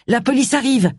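cri contenu, plus aigu; préviens à voix haute, sans hurler; cri d'alerte. A narrator's reading. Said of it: "cria-t-il à Allison". (alerte criée à mi-force)

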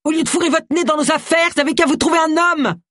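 screaming wife drives the detective off; shouted. A narrator's reading. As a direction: hurl it at her, furious. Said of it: "hurla-t-elle".

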